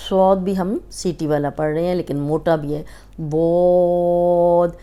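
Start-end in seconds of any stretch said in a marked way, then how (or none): 3.2-4.7 بود held long and heavy; slow heavy بود; heavy letter demo. Shown as a demonstration. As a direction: stretch بود thickly. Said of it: "یہ موٹا حرف ہے".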